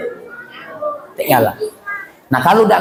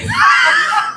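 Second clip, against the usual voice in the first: high laughing burst; burst of laughter. Real, not acted. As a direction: laugh out at your own joke. Laughs at joke. (tawa lepas tinggi)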